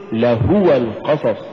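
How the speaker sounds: "لهو" in three syllables, la-HU-wa; correct لهو. Shown as a demonstration. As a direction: say لهو القصص with the u clearly sounded. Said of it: "قل".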